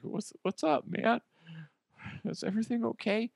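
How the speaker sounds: high, soft, timid questions; mock-anxious worker, small high voice; nervous employee. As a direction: ask meekly, dreading the answer. Acts nervous employee called in.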